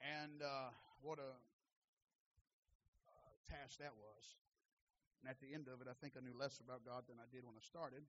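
low, very soft confiding voice; hushed reflection. Real, not acted. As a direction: let the voice drop right down, humbled. Subdued personal testimony after preaching.

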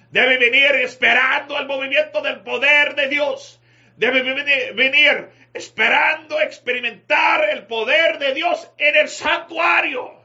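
high, loud, fervent preaching; prédica encendida. Real, not acted. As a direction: preach it at full force, pitched high and building to shouts. Preaches at full force. (predicación a toda voz, ferviente)

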